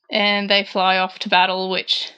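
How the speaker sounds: ordinary talk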